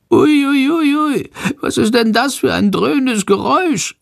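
very high, anxious bat squeal; frightened little bat. A narrator's reading. Bat asks the boy about the roaring noise, high and frightened. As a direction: squeal it like a scared tiny creature. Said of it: "fragt er ängstlich".

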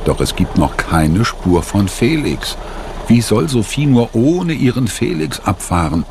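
ordinary delivery, nothing marked

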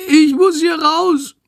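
very high, slow wail; desperate sick creature. A narrator's reading. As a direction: plead miserably in a tiny animal voice. Sick bat desperate to get out. (verzweifelt, sehr hoch und gedehnt)

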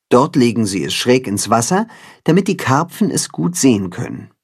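usual speaking voice

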